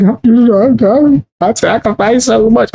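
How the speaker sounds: mock crying whine. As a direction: whimper it like a crybaby. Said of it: "came on here crying".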